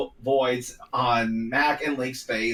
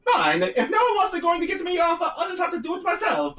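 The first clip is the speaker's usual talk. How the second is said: Mario voice; faster than usual. Acted.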